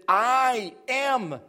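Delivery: "I" and "am" each stretched out with weight; slow, stressed "I am"; emphatic I. Shown as a demonstration. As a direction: lean hard on "I", hold "am". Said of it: "is emphatic".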